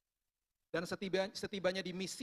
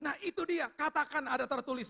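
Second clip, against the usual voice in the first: high, emphatic "that's it"; emphatic retold approval; 9 semitones higher, 4 dB louder than usual. God to the sick man who remembered, emphatic. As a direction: imitate a teacher pouncing on the right answer.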